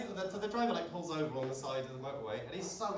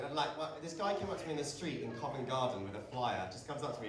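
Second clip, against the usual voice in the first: ordinary delivery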